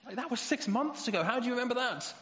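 put-on a kid who won't let it go; indignant little boy. A boy reminds his dad of a promise, in a high, loud, indignant child's voice. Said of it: "And they say".

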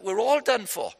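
high, loud wail of despair; mock despair. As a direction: act the disciples' hammed-up panic. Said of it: "they might have said it like that".

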